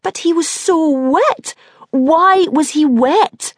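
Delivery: high, slow, loud disbelief. Acted. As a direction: shriek it out in disbelief. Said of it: "frowns at me for being loud".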